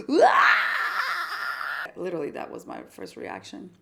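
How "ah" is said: high laughing shriek of panic; panicked scream. Retold or imitated. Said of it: "my first reaction was like".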